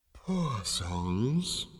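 soft, drawn-out ghostly voice; deep ethereal voice. A narrator's reading. Unseen voice in Zaphod's ear, deep and ethereal. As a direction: float the words out like a disembodied spirit. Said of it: "said a deep ethereal voice in Zaphod's ear".

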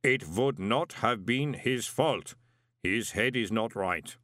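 slow, measured inhuman voice; matter-of-fact golem. A narrator's reading. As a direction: explain it word by word.

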